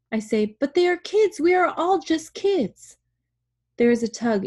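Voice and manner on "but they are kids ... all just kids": high, loud plea; distraught protest. A narrator's reading. Narrator pleads to those closing in for the kids, distraught and high. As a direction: plead for them, pitch soaring.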